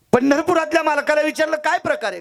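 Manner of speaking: loud, high-pitched proclamation; fervent preaching. Acted. Saint questioning the lord of Pandharpur, fervent and high. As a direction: launch it high to the whole crowd.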